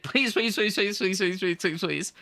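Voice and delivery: mock begging that tumbles downward; playful begging. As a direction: plead in a quick run, half-joking. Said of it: "without begging for it".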